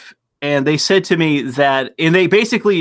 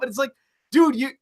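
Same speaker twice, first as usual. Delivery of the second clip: ordinary talk, nothing marked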